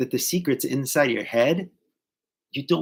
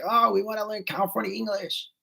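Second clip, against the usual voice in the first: higher eager student voice, lightly put on; a mock-keen, higher-pitched reply; 8 semitones higher than usual. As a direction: imitate keen students chiming in.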